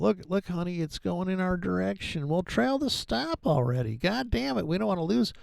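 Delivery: high, fretful mock voice to his wife; nervous trader. Mocking a nervous trader couple.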